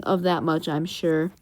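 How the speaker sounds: low, understated aside; Alfred. Retold or imitated. Alfred commenting on Bruce's trick, low and understated. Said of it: "we hear Alfred saying".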